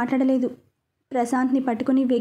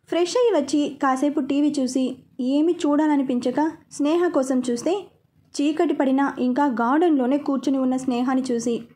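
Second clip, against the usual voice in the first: usual speaking voice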